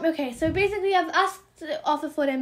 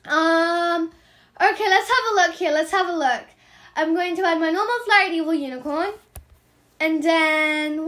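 usual speaking voice

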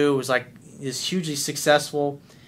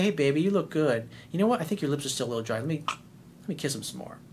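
softer and softer flirting, ending hushed; flirty tender; 6 dB quieter than usual.

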